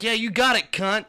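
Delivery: high, loud, laughing comeback; retelling a snarky comeback to someone who flipped him off; sarcastic retort. Retold or imitated. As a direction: fire back with a mocking laugh. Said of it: "I was like".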